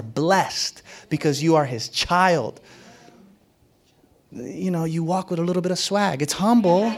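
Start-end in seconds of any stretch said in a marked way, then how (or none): 6.2-6.9 light chuckle. Real, not acted. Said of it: "but it's swaggy".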